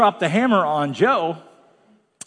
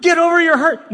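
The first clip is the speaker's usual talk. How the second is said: higher and louder, scornful; a preacher mocking a dismissive reply; loud mock retort; 11 semitones higher, 6 dB louder than usual. Shown as a demonstration. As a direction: bark it as the thing not to say. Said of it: "No, no, no, no, no".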